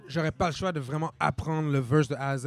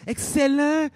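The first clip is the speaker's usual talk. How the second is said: put-on higher, louder scheming old villain; Mister Burns; 11 semitones higher, 7 dB louder, much slower than usual. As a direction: purr it like a cartoon tycoon. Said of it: "Mister Burns québécois".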